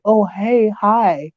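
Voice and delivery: high, sugary fake hello; mock a two-faced sweet greeting; fake friendly greeting. Imitating a fake-friendly neighbor's sugary hello. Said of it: "they always wanna be like".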